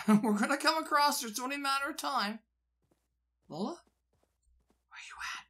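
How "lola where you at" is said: hushed whisper calling for someone. Real, not acted. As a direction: whisper the call so nobody hears. Calling softly for Lola.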